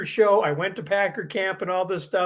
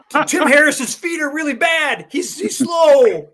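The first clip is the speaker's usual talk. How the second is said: louder, slow spy report; loud mock tip-off; 7 semitones higher, 5 dB louder, slower than usual. Spy calling the coach to tip him off, loud and slow. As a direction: blurt the secret out slowly and loudly. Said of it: "call Jerry Burns and go".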